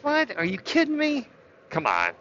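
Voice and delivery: high, stunned disbelief; incredulous. Real, not acted. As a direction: pitch jumps way up in disbelief. Outraged at policy.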